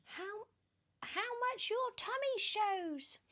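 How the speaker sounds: very high, sneering witchy voice; high mocking hag voice; spiteful Aunt Spiker. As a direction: jab at her sister with a high sneer. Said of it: "Aunt Spike cried".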